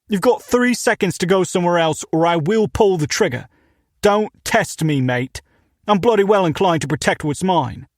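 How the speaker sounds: put-on thick British accent, shouted through the door; angry British shop owner yelling; loud British accent. Armed British owner threatening.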